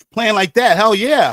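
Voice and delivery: retold enthusiasm. Retold eager reply to gig request.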